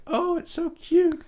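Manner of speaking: very high, slow and gushing; cute gushing voice. Retold or imitated. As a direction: coo it like something adorable. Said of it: "and she was like".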